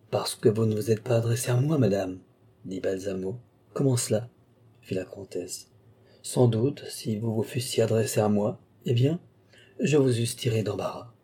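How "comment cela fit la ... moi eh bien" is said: plain reading voice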